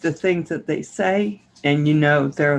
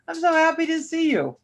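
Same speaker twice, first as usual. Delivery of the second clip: much higher, quick delighted hello; excited greeting; 11 semitones higher, faster than usual. Real, not acted. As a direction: gush with delight. Greeting a viewer warmly.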